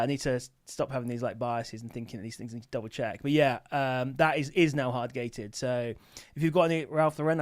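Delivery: ordinary talk, nothing marked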